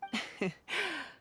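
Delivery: a brief breathy laugh; light laugh. Acted. Brushing off thanks.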